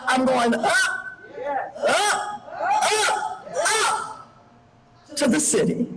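soaring rise on "up, up, up"; climbing up and up. Retold or imitated. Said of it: "in that big voice he would say".

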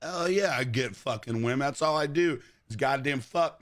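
ordinary talk

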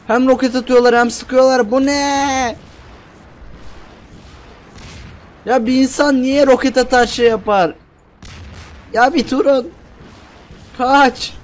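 shocked by the rocket fire; frantic exasperation. Real, not acted. Gamer under rocket fire protests in frantic alarm.

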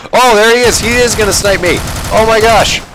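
high, loud burst of excitement; excited alarm. Real, not acted. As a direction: cry out as you spot the enemy sniper. Spots the sniper mid-game.

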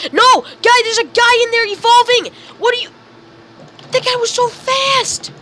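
high-pitched panicked excitement; astonished high yelp; alarmed outcry. Real, not acted. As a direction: warn the team, voice shooting up. Alarm at enemy evolving.

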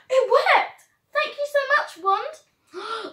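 high, loud delighted cry; thrilled the spell worked; excited. Real, not acted.

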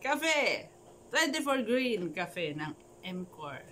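a joking fake commercial, hushed; mock coffee ad. (pabirong patalastas, pabulong)

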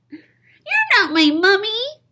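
put-on loud high little-kid cry; high loud child voice yelling Bones; surprised child shout. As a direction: shout it in startled disappointment. Child cries disappointed.

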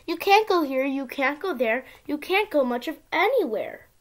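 usual speaking voice

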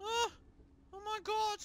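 ordinary talk, nothing marked

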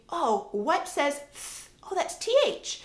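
put-on child thinking aloud. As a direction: act a child figuring out the letters. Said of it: "they can think".

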